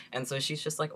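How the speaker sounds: ordinary talk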